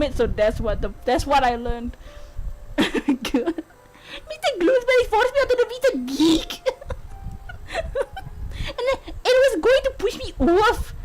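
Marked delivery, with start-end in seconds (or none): 4.3-10.9 high attempted Scottish accent, breaking into laughter. Bride recounts being pushed, in a high mock Scottish accent. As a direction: hold the shaky-hearted Scottish act through giggles. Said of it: "could do a Scottish accent".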